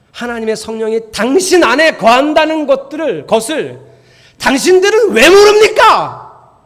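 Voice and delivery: building to a loud, high exasperated cry; rising from steady to loud and slow at the end; indignant rebuke. Shown as a demonstration. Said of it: "이렇게 읽어야 되는 성경이에요".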